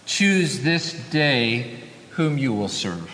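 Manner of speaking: slow, low and deliberate scripture quote; solemn challenge. Retold or imitated. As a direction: set each word apart, "Choose... this... day", falling low. Repeats Joshua's challenge to congregation.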